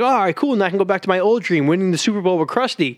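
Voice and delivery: high-pitched, mock-relieved cheer; pleased Bart. Bart retold in his dream, high and pleased. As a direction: imitate a kid delighted with himself. Retelling Bart's happy dream.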